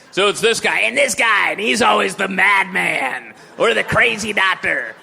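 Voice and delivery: loud, shouted mock radio sidekick; crazy DJ voice. Comic mocking the zany radio partner, shouted crazy voice. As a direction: bellow it like a wacky shock jock. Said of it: "his partner who talks like this".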